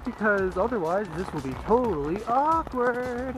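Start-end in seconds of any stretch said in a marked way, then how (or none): 1.7-3.3 playful drawl. Real, not acted. Playful drawn-out rising singsong.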